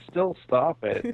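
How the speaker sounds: badly made cyborg sound; choppy, glitching robotic audio; half robot voice. Real, not acted. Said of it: "a really badly made cyborg".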